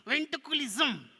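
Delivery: high, loud, slow puppet voice; struggling puppet. Pretend. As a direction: labour through the word as the puppet. Puppet tries the word.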